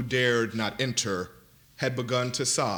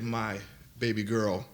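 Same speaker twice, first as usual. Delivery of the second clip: grieving; 2 semitones lower, 4 dB quieter than usual. A narrator's reading. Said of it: "told each face".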